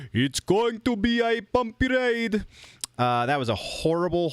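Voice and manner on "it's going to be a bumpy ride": bad high Jamaican accent, slow; hammy Jamaican accent, drawn out. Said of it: "a very bad Jamaican accent".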